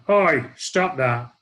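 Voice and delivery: loud, drawn-out "oi" reprimand; big bloke's loud, slow order to stop; retold telling-off. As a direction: call the kid out, loud and slow. Big bloke confronts misbehaving boy.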